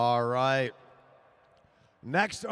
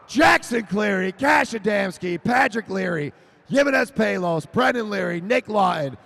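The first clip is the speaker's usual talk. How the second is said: loud, falling roll call of names; PA announcer listing results; reading the finish order; 3 semitones higher, 5 dB louder than usual. Real, not acted. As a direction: announce each rider in turn.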